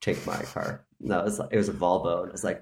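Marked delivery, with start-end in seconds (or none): 0.0-0.8 lightly voiced neighbour. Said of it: "was just like".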